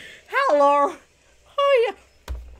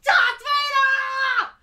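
a long, high, drawn-out fan scream of the name; excited shout; 10 semitones higher, much slower than usual. Real, not acted. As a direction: belt the name out, thrilled. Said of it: "I don't wanna blow your eardrums".

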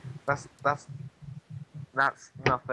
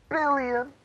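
excited; 10 semitones higher than usual. Real, not acted. Boasting huge in-game money.

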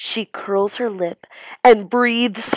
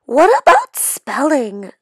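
put-on loud, higher shout of a kid; 7 semitones higher, 7 dB louder than usual. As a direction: call out loudly from the back. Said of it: "shouts Randy Potts".

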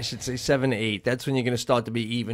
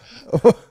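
loud laugh; 8 semitones higher, 13 dB louder than usual. Real, not acted. Friend laughs after the exchange.

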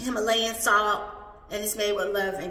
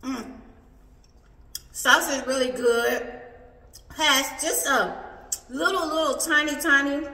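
speaking between bites of food; talking while chewing; 4 semitones higher, 3 dB louder than usual. Real, not acted. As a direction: mouth partly full of food. Seen 2: Bit taco with salsa at -3; still holding it, mouth working during words.

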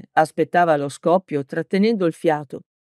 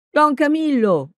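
shouted name; 9 semitones higher than usual. A narrator's reading. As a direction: yell his name across the field. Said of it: "urlò".

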